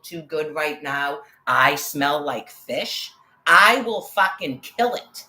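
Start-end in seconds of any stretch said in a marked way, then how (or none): 3.5-5.2 loud, high, slow furious threat; angry threat. Real, not acted. Woman ranting about the man who followed her, furious threat. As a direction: draw out the threat, loud and raised.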